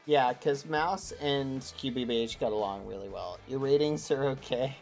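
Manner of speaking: ordinary talk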